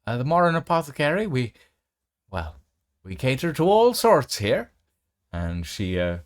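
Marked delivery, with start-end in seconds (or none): 0.1-4.7 put-on high, loud doting old granny; grandmother voice. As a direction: coo over her like a fussing grandma. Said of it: "your grandmother turns around and goes".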